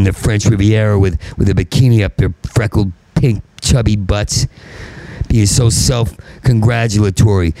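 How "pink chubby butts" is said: usual speaking voice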